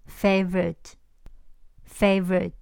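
favorite said slowly in two syllables, fave-rit; drawn-out two-beat British favorite; 英式两音节favorite. Shown as a demonstration. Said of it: "英国人说的是favorite".

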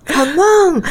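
breathy come on. Shown as a demonstration. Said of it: "要有点气音".

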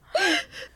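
sudden squeal of laughter; a quick nervous high laugh; laughing at the thought of dad coming. Real, not acted. Laugh at scary dad idea.

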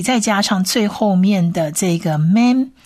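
ordinary talk

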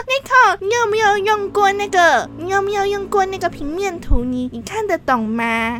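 high, condescending imitation of a coworker; mocking coworker. Imitating the coworker who doubted her at the meeting, mocking. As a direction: mimic his patronizing question. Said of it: "他说".

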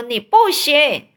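mock the rival kid's slow sneer; lightly voiced rival boy; rival boy retort.

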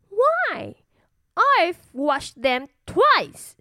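put-on high, slow, indignant little girl; cartoon princess. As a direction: protest like a stubborn little princess. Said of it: "said the little princess".

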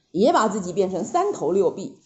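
ordinary delivery, nothing marked

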